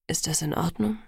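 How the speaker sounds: low, gentle, tentative question; understated uncertainty in a low voice; unsure. A narrator's reading. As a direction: check softly, a touch unsure. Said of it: "durch mein Schweigen verunsichert".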